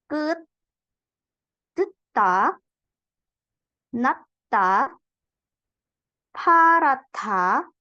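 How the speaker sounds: each word spaced out with long pauses, clear endings; reading drill. Shown as a demonstration. Teacher rereading the whole list for the student, word by word. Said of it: "kita coba ulang bacanya".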